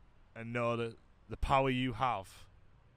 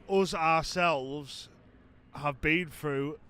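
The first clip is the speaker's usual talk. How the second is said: slow and earnest; slower than usual. Real, not acted. Sincere reply to critic.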